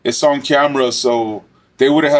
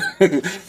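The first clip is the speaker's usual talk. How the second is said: short laugh; 5 semitones higher than usual. Real, not acted. Laughing at inmate under bunk.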